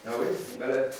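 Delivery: ordinary talk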